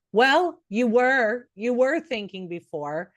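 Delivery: slow, stressed "Well"; knowing, emphatic reply; emphatic correction. Real, not acted. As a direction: drag out the "Well" to correct them. Correcting imagined listener.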